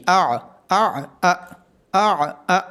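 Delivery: آ with a glottal jolt, then from mid throat; throat sound drill. Shown as a demonstration. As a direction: contrast hamza and ain. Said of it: "عین کی آواز گلے کے درمیان سے آئے گی".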